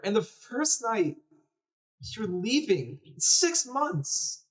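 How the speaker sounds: put-on high, accusing boy's voice; upset little brother. Little brother learns his sick sister is leaving, accusing. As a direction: confront her, pitch way up.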